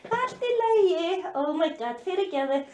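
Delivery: calm a nervous pet after yelling; soothing a dog. Real, not acted.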